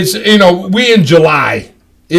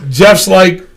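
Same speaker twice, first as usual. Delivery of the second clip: usual speaking voice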